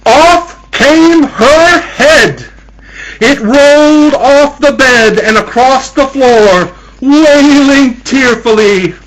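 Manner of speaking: loud, high, drawn-out narration; dramatic horror climax. A narrator's reading. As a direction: cry out the gruesome climax, stretching the words.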